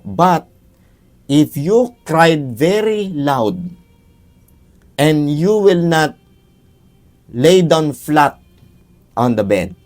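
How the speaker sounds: each word drawn out, firm; slow warning. Acted. Father warning the child of the condition, slow and firm. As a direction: spell out the condition slowly.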